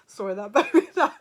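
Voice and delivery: loud laugh breaking the words; real laughter, can't get words out; laughing loudly through sorry. Real, not acted. Laughing at blunt message.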